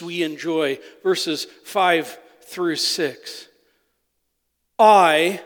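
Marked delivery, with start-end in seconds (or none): 4.8-5.4 "I" said loud, as one strong standalone word; emphatic I. Shown as a demonstration. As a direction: punch "I" with force. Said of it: "which is emphatic in the Hebrew".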